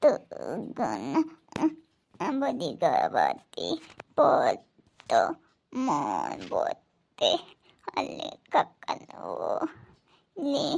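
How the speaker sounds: very low, very slow words, slurred and barely formed; the sick daughter struggles to get words out, slurred and slow; slurred halting speech. Real, not acted. Bedridden daughter struggling to answer her visitors, slurred and slow. Bedridden patient tries to speak.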